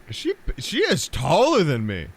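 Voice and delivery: loud, high-pitched flustered outburst; flustered gawking. Pretend. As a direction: gawk out loud, voice jumping up. Gawking at approaching giant figure.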